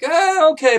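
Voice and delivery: high, squeaky acceptance; taken aback; startled okay. Retold or imitated. Narrator reacts to Lycus's pitch, startled.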